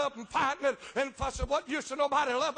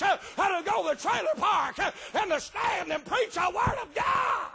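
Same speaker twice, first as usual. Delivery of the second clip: shouted, high preaching cadence with uh breaths; fiery preaching; 6 semitones higher, 5 dB louder than usual. Acted. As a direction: preach it at full force, shouting to the back pews. Preacher builds to full shouting.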